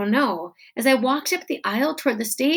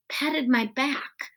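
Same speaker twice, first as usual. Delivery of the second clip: plain reading voice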